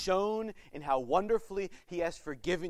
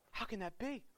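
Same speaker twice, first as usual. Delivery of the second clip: ordinary talk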